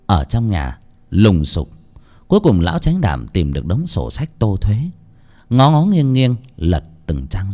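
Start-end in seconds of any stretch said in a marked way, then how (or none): none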